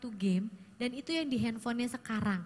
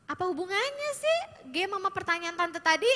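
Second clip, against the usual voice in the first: louder, irritated questioning; annoyed retort; 5 semitones higher, 5 dB louder than usual. Shown as a demonstration. Aunt dismisses teen's game talk, irritated. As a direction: push back impatiently. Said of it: "itu juga roadblocks".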